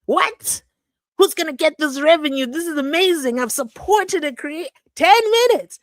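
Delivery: high, loud, building astonishment; mock amazed outburst. Herself caught inside an ad, loud mock amazement. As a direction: ham up the shock at a ten-minute ad. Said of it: "I was like".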